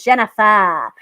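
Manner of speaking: imitated Massachusetts accent, "Jennifer" without its r. Comic quoting Jenny's mom on the name, in a Massachusetts accent. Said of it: "you know, with the accent".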